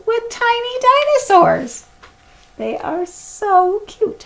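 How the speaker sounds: high, slow, doting voice; cooing. A narrator's reading. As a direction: gush over tiny babies. Cooing over baby dinosaurs.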